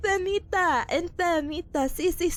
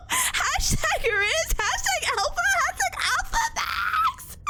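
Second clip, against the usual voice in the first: high-pitched, drawn-out hashtag squeal; mock hype; 12 semitones higher than usual. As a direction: squeal it slowly with a laugh breaking in. Playfully chanting silly hashtags.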